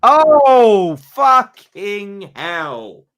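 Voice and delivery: loud drawn-out cry of despair; anguished yell. Real, not acted. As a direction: wail it out in real dismay. Goal conceded, anguished outburst.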